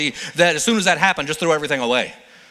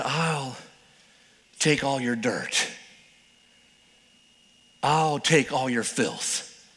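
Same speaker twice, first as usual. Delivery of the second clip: tender Jesus; 7 semitones lower, 6 dB quieter than usual. Acted. Jesus offering to take everyone's filth, low and tender. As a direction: gently offer to carry their burden.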